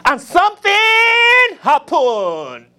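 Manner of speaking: high, slow preacher cry; soaring mock-preacher proclamation; Pentecostal preacher. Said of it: "why I love the Pentecostal preachers".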